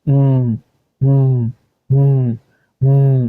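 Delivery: উম as a level, hummed "mmm"; held M sound. Shown as a demonstration. Teacher showing how to say M, উম hummed "mmm". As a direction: repeat উম as separate closed-lip hums. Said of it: "একটু এভাবে বলবো".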